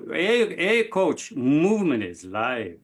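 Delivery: chanted name, then high, drawn-out catchphrase; Danny Rojas. Acted. Podcast guest trying out his impression for the coach, playful character voice. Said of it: "Danny Rojas impression. I've been working on it".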